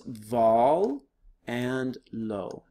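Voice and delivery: "vol" and "lo" slowly, apart; "vol and lo" as separated teaching syllables. Shown as a demonstration. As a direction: sound out "vol", pause, then "lo". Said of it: "So that gives us".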